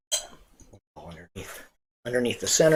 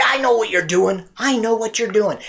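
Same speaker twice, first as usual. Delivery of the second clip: put-on higher, insistent young voice; lightly raised youthful voice; young know-it-all; 8 semitones higher, 4 dB louder than usual. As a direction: call out the magician as his younger self. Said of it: "My voice is probably a little higher".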